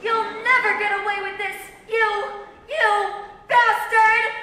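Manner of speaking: high, loud outburst slowing on the insult; child's scream. A narrator's reading. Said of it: "screamed the younger child".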